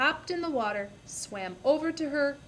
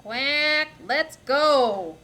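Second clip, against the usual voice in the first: louder, a duck-like quack as it says it; 3 semitones higher, 8 dB louder, much slower than usual. Acted. Duck agreeing to run with the hen, quacking loudly. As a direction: quack the line like an eager duck. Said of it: "quacked Ducky Lucky".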